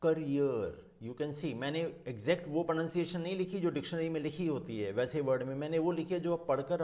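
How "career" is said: "career" as kuh-REER, falling; correct "career". Shown as a demonstration. Teacher correcting "my dear" student, careful correct stress. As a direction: lean on the second syllable of "career". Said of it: "this is".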